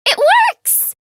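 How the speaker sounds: high, loud burst of joy; excited. Acted. Celebrating that the plan worked, high excited cry. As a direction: cheer the success out loud. Experiment succeeds.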